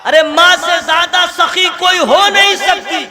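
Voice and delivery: loud, high impassioned sermon; fiery preaching. Acted. Preacher to the gathering, loud and impassioned. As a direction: preach it out at full force.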